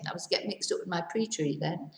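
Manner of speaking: usual speaking voice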